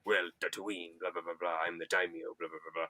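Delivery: low, quiet, mumbled Boba; a loose, dismissive take on the gruff mandalorian; Boba Fett impression. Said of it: "his character pretty much is just him being like".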